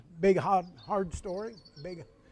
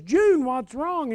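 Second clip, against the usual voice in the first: slow, higher, lightly voiced elderly grocer; retelling a kindly old grocer, slow and raised; old grocer's concern; 8 semitones higher, much slower than usual. Old grocer asking the upset mother what's wrong, slow and high.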